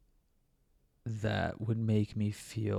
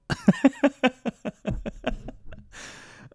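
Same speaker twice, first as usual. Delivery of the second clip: breathy high giggle; 4 dB louder than usual. Real, not acted. Laughs at himself again.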